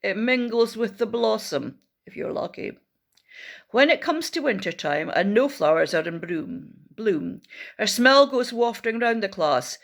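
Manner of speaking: usual speaking voice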